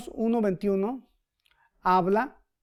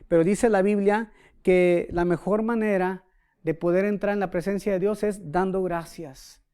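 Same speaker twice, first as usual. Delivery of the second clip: ordinary talk, nothing marked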